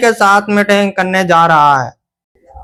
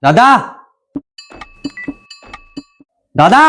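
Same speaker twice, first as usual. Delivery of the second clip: calling out. Acted. Calling out for grandpa.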